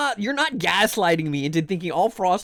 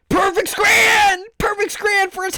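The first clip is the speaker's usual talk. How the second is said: triumphant shout; 14 semitones higher, 6 dB louder than usual. Real, not acted. Streamer celebrating a perfect score, loud triumphant shout. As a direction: belt it like a victory call.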